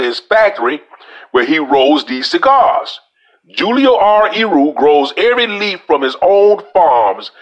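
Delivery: reading aloud. Acted. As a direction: read the website out like an announcement.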